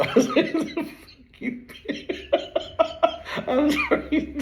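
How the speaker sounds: words squeaked high through laughing; real uncontrollable giggling fit; helpless laughter. Real, not acted. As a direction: laugh too hard to finish, then apologize. Collapses laughing, apologizes.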